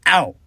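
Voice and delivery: high loud yelp of pain. Acted. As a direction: cry out as you hit the ground. Trips and falls.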